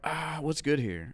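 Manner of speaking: slow, drawn-out mock indecision; dithering customer. Young customer finally at the bar's front, dithering slowly. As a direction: imitate a clueless customer taking their time.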